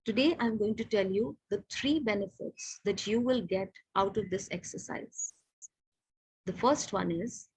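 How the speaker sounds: slow, soft, with deliberate pauses before the point; पॉज़ के साथ ज़ोर देकर, धीरे; pauses for emphasis. Shown as a demonstration. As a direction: let each pause land before you go on. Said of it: "उसके बाद एक हल्का पॉज लिया".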